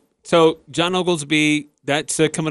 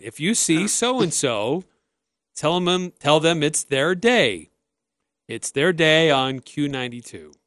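slow, measured announcer imitation; radio station liner; slower than usual. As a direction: draw it out like an old radio liner. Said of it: "they would do this thing where".

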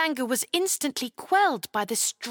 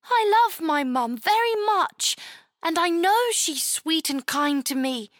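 put-on much higher, louder girl voice; Chloe pleading; 7 semitones higher, 3 dB louder than usual. Chloe telling the knight she loves her mum, earnest. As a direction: insist earnestly like a child.

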